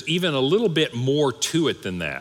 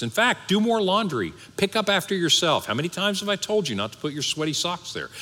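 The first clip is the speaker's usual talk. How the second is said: higher, quicker nagging voice of a wife; mock nagging wife; 7 semitones higher than usual. A preacher imitating a wife nagging her husband, mock nagging. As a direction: nag him like an exasperated wife.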